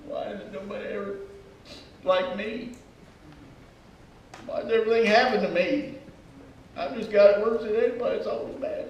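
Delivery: wallow in it like a sulker; self-pity. Acted. Sulker feeling hard done by, in a mock moan. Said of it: "A self-pity attitude".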